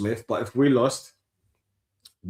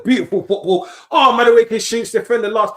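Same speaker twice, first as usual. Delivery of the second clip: excited commentary; 9 semitones higher, 7 dB louder than usual. Real, not acted. Attack building on the pitch.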